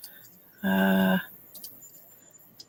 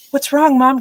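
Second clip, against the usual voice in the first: put-on higher, louder worried-kid question; lightly voiced alarmed son; imitating son; 7 semitones higher, 5 dB louder than usual. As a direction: call out as the startled child. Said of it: "so my son came downstairs, ... and he's like".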